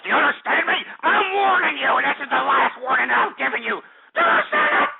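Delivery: loud yell building to a high shriek; escalating yell. Acted. Host's final warning, escalating.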